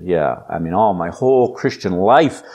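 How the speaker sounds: ordinary talk, nothing marked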